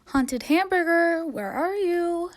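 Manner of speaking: slow, high and loud call. Acted. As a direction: call across the forest. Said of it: "she called".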